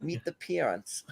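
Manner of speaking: careful put-on New Zealand vowels; New Zealand accent. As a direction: try a mock Kiwi accent slowly and quietly. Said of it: "I gotta keep trying it".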